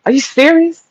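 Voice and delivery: a light mock of a shocked friend; mocking her disbelief. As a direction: throw her shocked question back. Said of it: "that's what she asked me".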